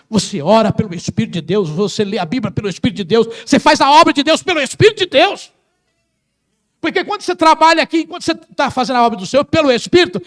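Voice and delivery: high, loud preaching over the music; full-force preaching. Acted. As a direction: drive it home like a pulpit climax. (pregação inflamada, alta e forte)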